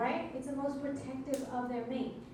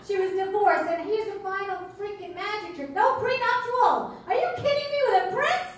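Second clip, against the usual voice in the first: mock outrage; 6 semitones higher, 7 dB louder than usual. Comic ranting to the crowd about the prince, mock outrage. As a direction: pile it on, higher each line.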